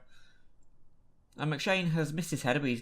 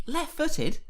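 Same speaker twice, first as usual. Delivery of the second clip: excited; 14 semitones higher, 4 dB louder than usual. Real, not acted. Celebrating goal.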